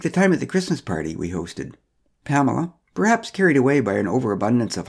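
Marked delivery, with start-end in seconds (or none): none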